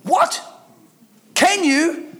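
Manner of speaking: high-pitched, disbelieving echo; incredulous. Acted. Preacher throwing doubters' question back at them, incredulous.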